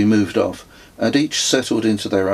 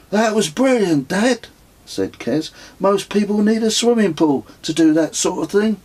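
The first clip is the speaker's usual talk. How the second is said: son's voice; 12 semitones higher than usual. A narrator's reading. Son teasing his fallen dad, higher cheeky boy. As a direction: voice the teasing child.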